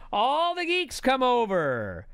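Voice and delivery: usual speaking voice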